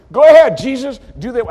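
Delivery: high, loud mocking taunt; mocking Herod. Herod taunting Jesus to do tricks, loud and mocking. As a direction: goad him like a jeering king. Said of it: "make fun of him".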